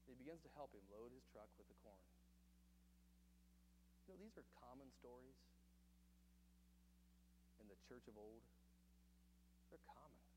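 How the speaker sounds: soft, low story voice drawing listeners in; a quiet parable told to the congregation; hushed storytelling. Real, not acted. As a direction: tell it gently, almost under the breath.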